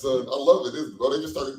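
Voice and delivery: ordinary delivery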